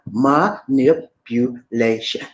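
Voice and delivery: "Manipulation" very slow, broken into five syllables. Shown as a demonstration. Host teaching viewers to say it, "Manipulation" in slow separate syllables. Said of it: "That's a five-syllable word. Five syllables".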